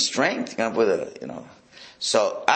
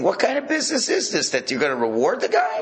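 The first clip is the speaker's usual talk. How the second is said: raised-pitch, affronted question; incredulous challenge; 7 semitones higher than usual. Acted. Teacher voices David questioning the reward, incredulous. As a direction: challenge them in disbelief. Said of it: "he's saying".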